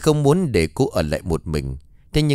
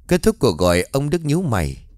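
ordinary delivery, nothing marked

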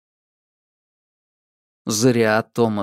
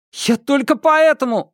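panicked pleading; 14 semitones higher, 4 dB louder than usual. Acted. Thomas pleads, cut off.

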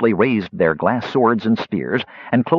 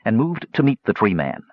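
ordinary delivery, nothing marked

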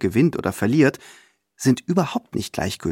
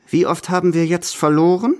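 higher and louder, tight with impatience; impatient grinding question; 7 semitones higher, 5 dB louder than usual. Acted. Grandmother pressing her companion about losses, impatiently. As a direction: ask it through gritted teeth. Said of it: "vor Ungeduld mit den Zähnen knirschend".

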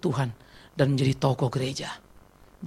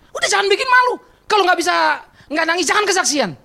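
high, loud acted telling-off; angry scolding; 13 semitones higher, 5 dB louder than usual. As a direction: re-enact a son snapping at his mother. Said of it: "Dia saya marahin kesaksian". (menirukan anak memarahi ibu, nada melengking)

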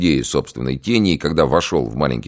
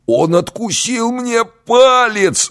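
high, slow pained yell; 5 dB louder, much slower than usual. Acted. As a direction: howl it out in agony. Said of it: "заорал жердяй".

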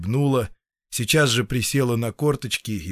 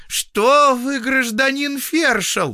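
very high, loud, drawn-out protest; indignant peasant woman; 5 dB louder, slower than usual. A narrator's reading. Patient protesting the feldsher's accusation, indignant. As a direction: wail in injured innocence.

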